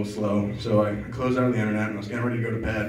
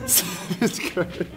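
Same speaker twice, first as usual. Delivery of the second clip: laughing; 6 semitones higher, 3 dB quieter, much slower than usual. Real, not acted. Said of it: "repeats band name laughing".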